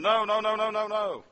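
retold screaming. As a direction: cry out as the plane veers from the runway. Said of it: "we all start screaming".

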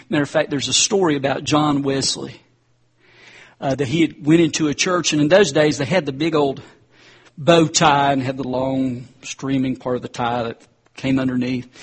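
normal talking voice

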